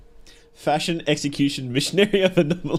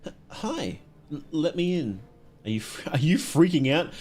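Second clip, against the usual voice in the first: usual speaking voice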